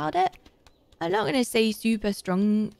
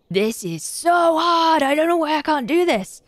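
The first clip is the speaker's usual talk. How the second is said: higher, louder, slow drawn-out complaint; frustrated outburst; 6 semitones higher, 4 dB louder than usual. Real, not acted. A gamer straining at a hard level, frustrated wail. Struggling to steer in game.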